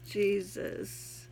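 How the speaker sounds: ordinary talk, nothing marked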